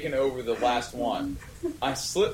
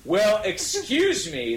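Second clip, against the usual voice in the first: indignant shout; 12 semitones higher, 4 dB louder, slower than usual. A narrator's reading. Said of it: "they both shouted angrily".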